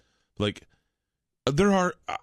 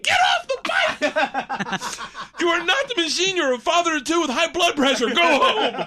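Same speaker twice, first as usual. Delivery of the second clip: higher and louder, laughing through the mock scolding; scolding wife; 4 dB louder, slower than usual. Wife on the phone ordering him home, mock shrill scolding. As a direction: nag him home like a fed-up wife, but hammed-up. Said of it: "and she's like".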